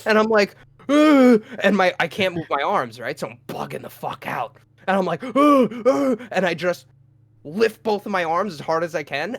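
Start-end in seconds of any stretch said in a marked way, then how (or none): 0.9-1.4 high, loud startled "ugh"; imitated shocked grunt. Just punched awake, imitated shocked grunt. 5.2-6.2 two high, startled grunts; imitated panicked grunts. Arms pinned in bed, imitated panicked grunts.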